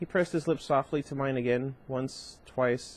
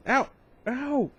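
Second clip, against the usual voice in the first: sharp pained cries, pitch leaping higher; screaming in pain; 11 semitones higher, 3 dB louder than usual. A narrator's reading. Narrator voicing Bella's pain after punching Jacob, high yelps. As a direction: yelp it out like a broken hand. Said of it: "I screamed".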